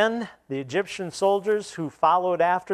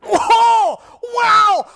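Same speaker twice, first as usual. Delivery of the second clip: put-on much higher, loud whooping surfer kid; ecstatic dude shout; 9 dB louder than usual. Young man answering the scholar, ecstatic dude shout. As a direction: yell it with total delight. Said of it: "the kid goes".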